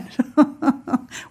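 short real laugh; amused laugh. Real, not acted. Laughs at bird interruption.

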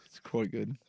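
soft posh British "quite", rising; a quiet, prim English "quite good"; posh British accent. Pretend. Cohost answering the accent joke, in quiet posh British.

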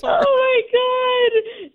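high shrieking laughter. Real, not acted. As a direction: cackle through the reveal. Wife reveals prank laughing.

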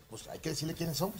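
ordinary delivery

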